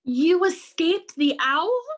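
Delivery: high, loud, amazed question; astonished cry. Acted. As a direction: cry out in disbelief, voice rising. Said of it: "cried Stella Luna".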